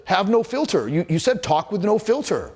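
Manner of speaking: defensive client. Retold or imitated. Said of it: "And he said".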